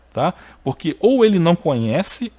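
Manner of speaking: ordinary talk, nothing marked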